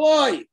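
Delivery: groaning oy. Retold or imitated. Israelites in Egypt just groan, loud mock oy. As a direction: sigh out a heavy oy. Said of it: "They just go".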